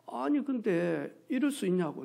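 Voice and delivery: usual speaking voice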